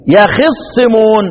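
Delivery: يخصمون slowly recited with an "i" on the kha; kasra on kha. Shown as a demonstration. Teacher repeating the kasra reading, slow recitation. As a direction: say ya-khis-simun for يخصمون, leaning on the ص. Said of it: "بكسر الإيه؟ الخاء".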